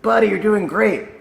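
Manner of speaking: high, loud praise to a dog; thrilled showman quizzing his pet; man cheering dog on. Acted. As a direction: urge your dog on excitedly. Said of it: "And the guy goes".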